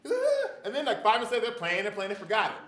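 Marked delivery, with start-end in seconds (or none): 0.1-0.5 very high, loud wail; child's cry. Retold or imitated. A child just hit, wailing loudly. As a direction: shriek like a hurt little kid. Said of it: "What's going on".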